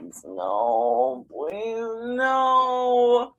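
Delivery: whispered then rising "please, no"; mock dread pleading. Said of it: "I'm like".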